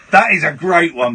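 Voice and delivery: ordinary talk, nothing marked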